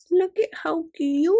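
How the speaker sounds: high, loud gushing coo; high-pitched excited gush; delighted squeal. Real, not acted. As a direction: squeal it high over something adorable. Delighted at opened washi.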